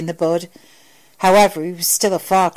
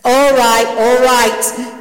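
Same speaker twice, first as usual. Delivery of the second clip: loud, higher cutting-in; fed-up raised voice; exasperated interruption; 5 semitones higher, 6 dB louder, much slower than usual. A narrator's reading. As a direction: break in loudly to stop him. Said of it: "Doctor Beckett interrupted the Admiral's fantasy life".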